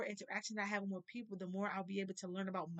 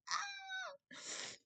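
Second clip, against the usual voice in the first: a high, sharp cry breaking out; sudden cry; 5 dB quieter than usual. Real, not acted. Fixing her eyeliner on camera, a sudden high cry.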